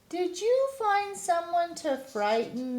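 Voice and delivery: high teasing call. Acted. The laughing animals calling to the bear, high teasing call. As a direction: call it across playfully. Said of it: "they called".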